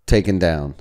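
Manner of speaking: normal talking voice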